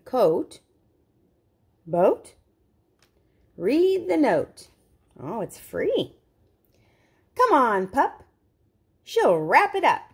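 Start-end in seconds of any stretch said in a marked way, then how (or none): none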